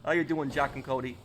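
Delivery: exaggerated New York greeting in a New York accent. Shown as a demonstration. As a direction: lay the New York accent on heavier, like a street guy. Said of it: "I can also do, like, a, uh, a deeper New York accent".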